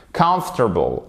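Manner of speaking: "Comfortable" squeezed to KUMF-tuh-bul; correct comfortable. Shown as a demonstration. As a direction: stress first syllable of "Comfortable", falling after. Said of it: "il faut savoir le prononcer".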